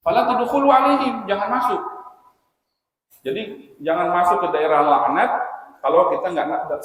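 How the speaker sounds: loud, high-pitched preaching in emphatic bursts; forceful preaching. Real, not acted. Preacher warning listeners off cursed places, loud forceful preaching. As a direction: drive the warning home at full force.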